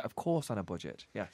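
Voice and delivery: ordinary talk, nothing marked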